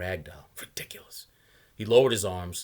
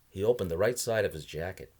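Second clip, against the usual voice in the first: ordinary delivery, nothing marked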